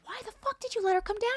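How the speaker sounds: very high-pitched, incredulous angry demand; mad. Retold or imitated. Daughter furious at dad on the phone, high-pitched outrage. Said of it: "I was so mad".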